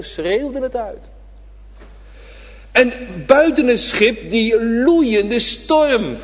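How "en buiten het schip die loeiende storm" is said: preacher proclaiming Jesus' voice over the storm; full-force preaching. Acted. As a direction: let the voice soar over the storm. Preaching the powerful voice.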